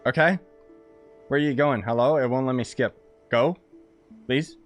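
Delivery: ordinary talk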